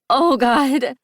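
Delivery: high, loud breathless gasp of pleasure; cry of pleasure. A narrator's reading. Heroine overwhelmed with pleasure, crying out high. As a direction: let it burst out high. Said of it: "I say".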